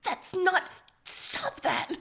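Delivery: flustered, hissing stop that; hissed protest. Acted. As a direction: hiss it at her to make her stop. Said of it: "Karkat hisses at her".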